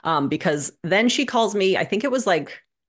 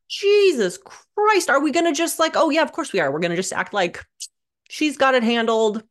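exasperated disbelief; 7 semitones higher than usual. Retold or imitated. Daughter to her dad about mom's vomiting, exasperated. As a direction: throw up your hands at how absurd it is.

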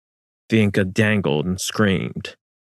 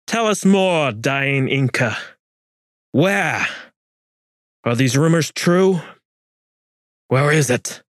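menacing interrogation; 6 semitones higher than usual. A narrator's reading. Gonzalo pressing the dying Inca for answers, menacing. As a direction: lean over him and demand answers.

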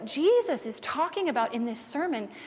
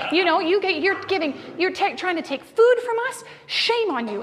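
louder, higher indignant rebuke; indignant scolding; 6 semitones higher, 6 dB louder than usual. Retold or imitated. Friar rebuking the robber, mildly indignant. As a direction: shame him, building up heat. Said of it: "the brother shames the guy down".

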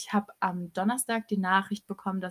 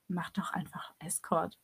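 retold whispered suggestion; 4 semitones lower, 8 dB quieter than usual. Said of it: "er so".

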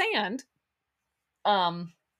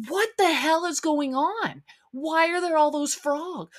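higher, alarmed cry of disbelief; 8 semitones higher than usual. Retold or imitated. Telling viewers about the frogs, in replayed alarmed disbelief. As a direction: recoil at the sight of more frogs. Reliving seeing frogs in the yard.

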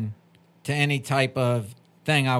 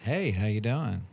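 deliberately lowered, deeper greeting; dropped voice; 7 semitones lower, 4 dB quieter than usual. Real, not acted. Host's husband greeting podcast listeners, dropped voice. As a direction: drop your voice and add some bass. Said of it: "how I dropped my voice".

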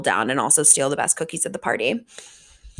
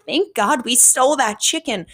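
imitating herself; 7 semitones higher than usual. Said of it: "And I was like".

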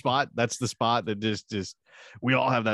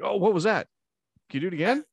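much higher, eager and delighted; high excited mock voice; 9 semitones higher, faster than usual. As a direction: squeal it like a thrilled lover. Voicing her delighted reaction.

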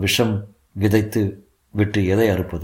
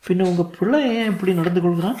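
higher-pitched lady teacher voice; 9 semitones higher than usual. A narrator's reading. Teacher questioning a pupil's father, lady teacher voice.

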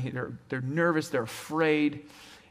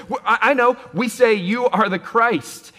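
an eager, raised, slowed answer; eager Peter; 6 semitones higher, 6 dB louder than usual. Acted. Peter to Jesus, eagerly blurting his confession.

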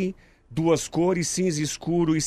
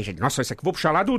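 ordinary talk, nothing marked

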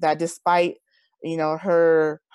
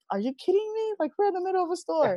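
high-pitched, incredulous retold reaction; squeaky mock exasperation; exasperated disbelief; 11 semitones higher, 4 dB quieter, faster than usual. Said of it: "and I'm just like".

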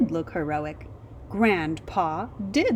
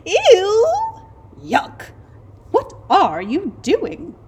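high, loud, grossed-out cry; disgusted mole rats; 12 semitones higher, 9 dB louder, slower than usual. A narrator's reading. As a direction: squeal in disgust. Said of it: "they said".

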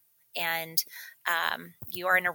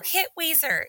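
much higher, playful movie-line voice; quoting Steel Magnolias; 11 semitones higher than usual. Retold or imitated. Friend recalling the funeral scene, high playful mock quote. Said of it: "where they're like".

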